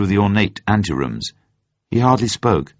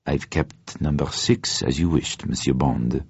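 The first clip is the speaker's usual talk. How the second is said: discreet attendant; 5 semitones lower, 6 dB quieter than usual. Acted. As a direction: murmur it discreetly to a valued regular. Chef de partie greets Bond.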